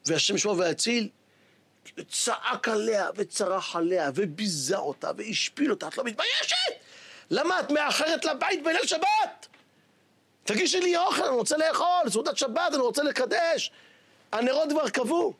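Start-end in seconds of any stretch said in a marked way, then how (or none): 5.9-15.3 angry husband's rebuke. Retold or imitated. Husband berates late wife.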